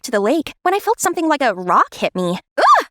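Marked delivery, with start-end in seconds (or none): 2.6-2.8 sharp loud grunt of being hit; pained grunt. Acted. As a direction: grunt as something strikes you. Hit by a rock.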